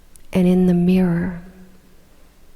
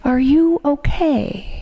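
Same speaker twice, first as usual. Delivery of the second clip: put-on slow, higher Russian-accented question; a light Russian accent, asked with slow concern; heavy Russian accent; 5 semitones higher, slower than usual. Man beside him asks gently in a Russian accent. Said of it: "said in a very heavy Russian accent".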